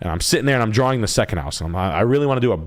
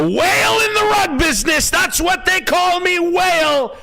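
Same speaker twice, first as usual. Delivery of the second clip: very high, drawn-out mock shout; hammed-up boast; 4 dB louder, slower than usual. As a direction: stretch it into a high wailing yell. Clowning boast about rug sales.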